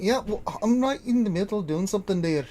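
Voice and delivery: mildly put-upon tinkerer voice; preoccupied father. Acted. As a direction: fend off an interruption in character. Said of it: "he turns around".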